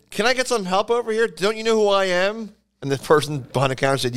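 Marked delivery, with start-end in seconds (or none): 0.1-2.5 higher-pitched, demanding customer; indignant Gallagher. Retold or imitated. Comic cuts into a store line demanding service, indignant. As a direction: play the impatient star wanting service. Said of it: "And he said".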